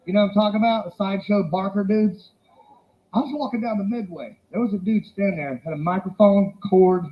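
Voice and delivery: ordinary delivery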